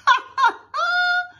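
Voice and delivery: put-on high, loud kids' laughter; burst of laughter. Kids laugh at the costumes, loud high cackling. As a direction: burst out in shrieking mock giggles. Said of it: "the kids burst out laughing".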